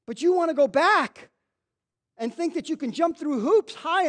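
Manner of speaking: loud, high preacher's cry; full-force preaching. Acted. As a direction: preach it hard to the congregation.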